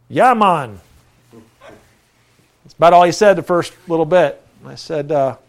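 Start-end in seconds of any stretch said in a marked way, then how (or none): 0.1-0.8 put-on Jamaican accent. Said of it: "You know, real Jamaican".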